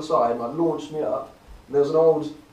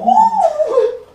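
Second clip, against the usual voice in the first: loud high-pitched cackle of real laughter; burst of high laughter; 12 dB louder than usual. Real, not acted. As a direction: break into a shrieking laugh. Laughing at the mockery.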